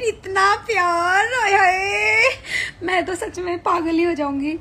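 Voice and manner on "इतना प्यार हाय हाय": slow, high, laughing squeal; overwhelmed delight. Real, not acted. Streamer reading her fan count, overwhelmed squealing delight. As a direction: let the joy spill over into giggles.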